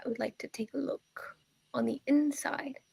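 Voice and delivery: put-on soft, whispered wise old woman; old lady voice. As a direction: hush it like a mystic crone. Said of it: "my old lady voice".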